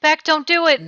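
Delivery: loud, high desperate plea; pleading shout. Acted. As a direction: shout to stop her. Friend pleads with Beck.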